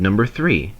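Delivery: ordinary delivery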